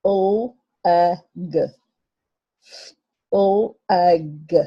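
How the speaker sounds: O A G sounded out slowly, letter by letter; teacher sounding out letters. Shown as a demonstration. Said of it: "let's read it together".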